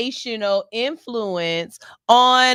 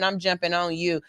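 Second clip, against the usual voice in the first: ordinary talk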